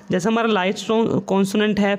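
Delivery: ordinary delivery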